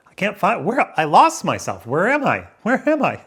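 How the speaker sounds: hammed-up lost-and-searching cry; mock panic. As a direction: play at frantic searching, pitch climbing. Acting out losing himself, searching.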